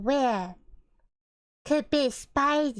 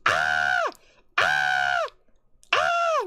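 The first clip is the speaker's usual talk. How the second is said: mock screams; much slower than usual. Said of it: "you'd be screaming".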